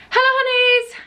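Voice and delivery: high, loud sing-out hello; over-bright channel opener; vlog intro greeting. Shown as a demonstration. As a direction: put on a big bubbly intro voice. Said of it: "can't even do my own intro".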